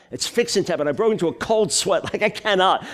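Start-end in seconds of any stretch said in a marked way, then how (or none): none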